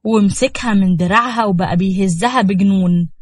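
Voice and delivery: ordinary delivery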